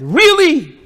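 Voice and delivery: high, loud mock disbelief; incredulous. Real, not acted. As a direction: throw it back in disbelief. Incredulous rhetorical retort.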